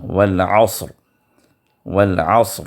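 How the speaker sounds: والعصر with a heavy, full ر at the stop; والعصر, ر thick after ص. Shown as a demonstration. Teacher repeating والعصر with a heavy ر. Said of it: "اس کو بھی پر پڑھنا ہوگا".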